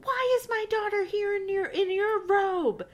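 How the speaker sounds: high-pitched indignant yell; mock yelling. Charles yelling at Almanzo about his daughter, high mock yell. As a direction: demand it like an outraged father. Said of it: "Charles starts yelling".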